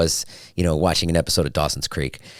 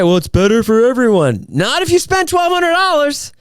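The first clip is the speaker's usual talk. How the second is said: mock whiny; 5 dB louder than usual. Mock objection then high retort.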